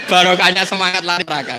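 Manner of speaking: high laughter into the line; laughing. Real, not acted. Preacher laughing at his own punchline to the congregation. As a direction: laugh then say it still laughing.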